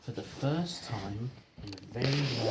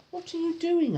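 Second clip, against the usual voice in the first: much higher, curious question; Mister Greedy asking; 5 dB louder than usual. Acted. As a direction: ask it with wide-eyed curiosity. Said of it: "asked Mister Greedy".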